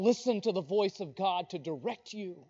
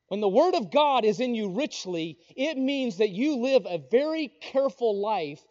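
usual speaking voice

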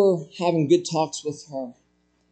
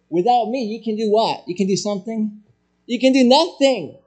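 rising to a loud call on nothing; a pastor driving the point home; preaching; 5 semitones higher, 3 dB louder than usual. Acted. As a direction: preach it, building to the punchline.

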